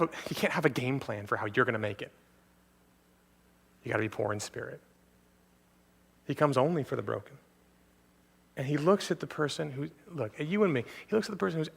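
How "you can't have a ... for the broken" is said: usual speaking voice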